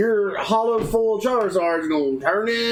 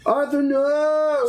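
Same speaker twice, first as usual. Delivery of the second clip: high, drawn-out cry of dismay; theatrical cry at losing a friend; mock-dramatic cry; 9 semitones higher, much slower than usual. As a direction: wail it slowly, hammed-up. Dramatic cry as Arthur is absorbed.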